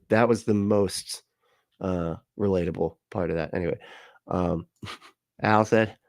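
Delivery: ordinary talk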